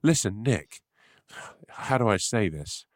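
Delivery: slow, lightly put-on hesitant voice; changed, nervous voice. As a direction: ease into the bad news slowly. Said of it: "Your voice has changed a little bit".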